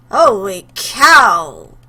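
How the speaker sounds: loud, drawn-out exclamation of shock; real startled outburst. Real, not acted. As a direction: player reacting to a gory scene, shocked. Player reacts to shocking scene.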